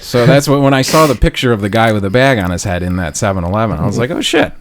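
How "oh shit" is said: sudden high oh shit; spotting the robber's photo; startled recognition. Retold or imitated. Retold recognition.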